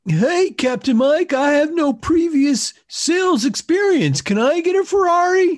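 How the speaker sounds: put-on high, loud, eager kid; squeaky excited newbie. Plays eager naive recruit.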